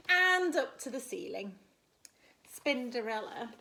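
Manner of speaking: usual speaking voice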